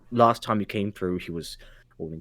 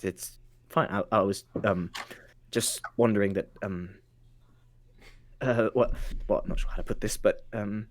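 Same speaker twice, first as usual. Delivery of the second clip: hesitant, softened voice; halting, discreet murmur; confidential whisper; 3 semitones lower, 6 dB quieter than usual. Acted. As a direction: fumble for tactful wording under the breath. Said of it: "so he can just whisper something".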